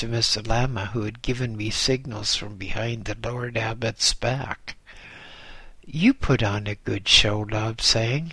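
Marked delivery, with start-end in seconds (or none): none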